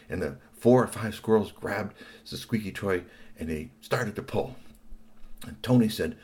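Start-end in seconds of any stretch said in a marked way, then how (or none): none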